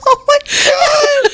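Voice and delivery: high, cracking up in disbelief; laughing shock. Real, not acted. Hearing the double dick story, laughing. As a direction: gasp it out laughing.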